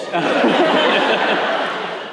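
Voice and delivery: a high burst of laughter; laugh, delighted. Real, not acted. Speaker laughing about the dinner bet.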